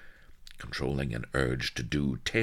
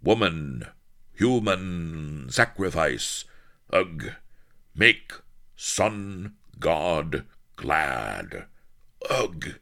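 clipped caveman pidgin, slightly higher and louder; jungle pidgin talk; 4 semitones higher, 4 dB louder, much slower than usual. Acted. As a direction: grunt it out like a jungle native. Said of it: "She knew how to talk like a jungle denizen".

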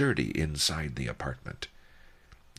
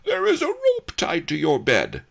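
yelling; 14 semitones higher, 8 dB louder than usual. A narrator's reading. The governor yells from the window, discovering the rope. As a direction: bellow it in shock. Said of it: "the lout yelled above us".

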